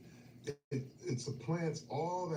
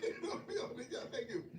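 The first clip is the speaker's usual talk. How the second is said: high, laughing brush-off; amused refusal, laughing through it; 12 semitones higher, 5 dB quieter than usual. Real, not acted. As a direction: giggle it off high. Laughing aside after story.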